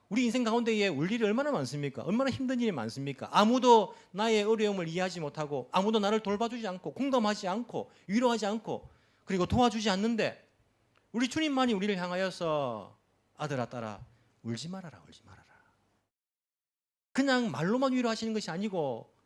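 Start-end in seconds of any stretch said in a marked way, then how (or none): 0.1-13.0 high, loud pleading sermon build; impassioned preaching. Acted. Pastor to congregation, loud rising preaching. 13.4-15.4 lower, fading soft reassurance; tender comfort. Acted. Jesus comforting his children, softly fading.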